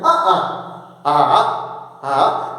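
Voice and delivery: "Ah, ah" cut sharply by a glottal stop; glottal stop demo. Shown as a demonstration. Teacher shows students how the glottal stop cuts "ah". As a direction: catch the throat between each "ah". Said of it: "Te van diciendo".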